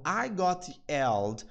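very slow, split phrase; slow demo. Shown as a demonstration. Teacher explaining the line to students, slow. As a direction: lay it out slowly. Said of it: "Então".

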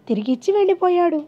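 ordinary delivery, nothing marked

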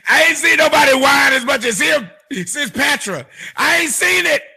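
high, loud exclamation of disbelief; mock astonishment. Real, not acted. Host ridiculing how much her man whines, mock astonishment. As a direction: exclaim it high, as if utterly amazed. Host mocking the man's whining.

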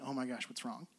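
very soft, low acted question; hushed concern. Husband shushed by wife during true crime episode, hushed concern. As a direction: drop right down, imitating a hushed worried husband.

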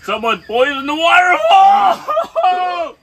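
slow, loud, high wail; dramatic shout. Real, not acted. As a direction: yell it out like a disaster. Burning spice, joking outcry.

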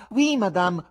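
high mock-courteous clown voice; over-polite buffoon; insolent buffoon. A narrator's reading.

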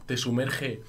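usual speaking voice

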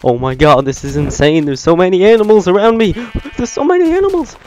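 loud, climbing into a high squeal; amazed shouting. Real, not acted. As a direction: shriek with mounting excitement. Overwhelmed by animals in the game.

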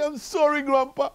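put-on very high, loud toddler wail; crying granddaughter. Smacked toddler says sorry to grandpa, mock crying. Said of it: "she's crying, and goes".

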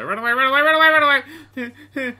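high, loud comic fleeing chant; mock-panicked cry. Player flees from sharks, high mock-panicked cry. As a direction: squeal it in playful panic.

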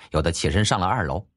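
ordinary delivery, nothing marked

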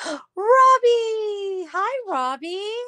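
gasping, high, loud greeting; light up at a favourite name; excited squeal. Real, not acted. Streamer spots a regular viewer, excited high greeting.

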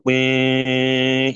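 a steady drawn-out "Wing" like a monitor tone; imitated flatline beep. Teacher mimics the ECG monitor for nursing students, steady beep. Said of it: "it will do".

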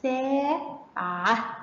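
"ساعة" very slow with a held aa; syllable-by-syllable "ساعة"; long vowel. Shown as a demonstration. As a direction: stretch سا, pause, then عة in "ساعة". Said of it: "خدنا الأصوات الطويلة".

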